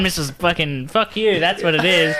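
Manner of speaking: normal talking voice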